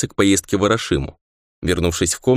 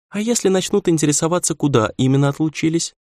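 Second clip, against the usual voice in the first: put-on chirpy girl voice; 9 semitones higher than usual. A narrator's reading. Girl Yui pressing questions.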